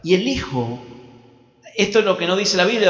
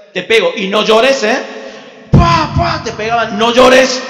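stern parent scolding; 5 semitones higher, 8 dB louder, slower than usual. Retold or imitated. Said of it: "esos padres que antes te decían".

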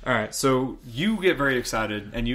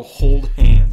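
louder and slow, a joking proclamation; mock loud vow; 18 dB louder than usual. Real, not acted. Joking to the cohost about holding hands, loud and slow. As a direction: declare it like a solemn promise.

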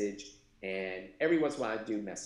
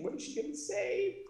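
anxious thought. Retold or imitated. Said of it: "I'm thinking".

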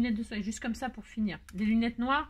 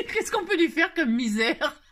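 giggling; 5 semitones higher, 7 dB louder, slower than usual. Real, not acted. Giggling at dressing the doll.

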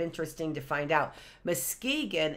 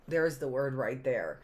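ordinary delivery

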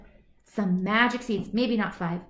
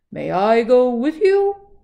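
bull voice; 3 semitones higher, 11 dB louder than usual. Acted. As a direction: boom the question like a bull. Bull asks to join.

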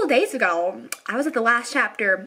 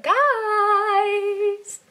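drawn-out call; 9 semitones higher than usual. Real, not acted.

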